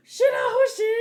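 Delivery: gasp, then a high loud cry of her name; shocked shout. Acted. The brother crying out in shock at his sister's refusal. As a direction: yell her name in alarm.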